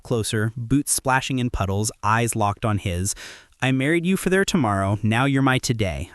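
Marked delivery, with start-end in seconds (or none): none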